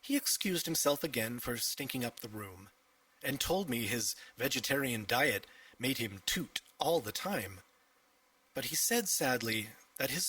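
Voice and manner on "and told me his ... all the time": usual speaking voice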